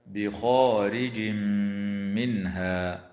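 slow recited "بخارج منها", tanween merged into a hummed m; idgham into meem. Shown as a demonstration. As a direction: hold the nasal mm from "بخارج" into "منها". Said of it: "وفي الميم من قوله تعالى". (إدغام تنوين "بخارج" في ميم "منها" بغنة)

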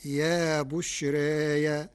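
slow "بشراي" as bushreeya; Warsh taqlil reading. Shown as a demonstration. Sheikh shows the Warsh form, "بشراي" drawn out as bushreeya. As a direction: tilt the long aa of "بشراي" toward e, then add ya. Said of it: "بياء مفتوحة بعد الألف".

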